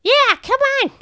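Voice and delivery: very high squeaking rat; squeaky rat voice. Acted. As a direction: chirp like an eager rat. Rat NPC urges on.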